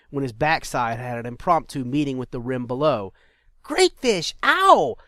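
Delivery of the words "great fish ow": high loud cry of pain; startled yelp. Acted. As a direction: yelp as you fall hard. Slips and falls.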